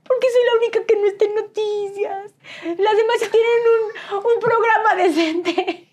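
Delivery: high whiny half-laughing lament; mock crying complaint. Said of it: "Y yo lloraba". (queja llorona exagerada, aguda y entre risas)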